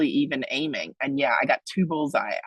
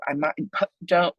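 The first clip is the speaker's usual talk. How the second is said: normal talking voice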